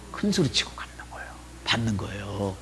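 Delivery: hushed aside; whisper. Real, not acted. Pastor whispering an aside to the church. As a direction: whisper it to the congregation.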